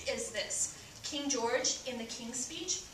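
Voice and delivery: ordinary delivery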